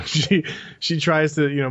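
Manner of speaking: usual speaking voice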